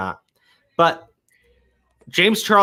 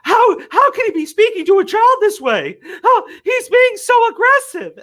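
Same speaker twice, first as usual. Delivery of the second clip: much higher, louder pearl-clutching whine, slowing at the end; mocking outraged voice; 5 dB louder than usual. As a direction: parody scandalized Twitter users, high and shrill with laughter. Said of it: "people on Twitter going like".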